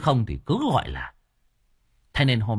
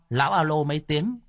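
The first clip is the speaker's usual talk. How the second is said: plain reading voice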